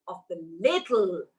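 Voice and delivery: ordinary delivery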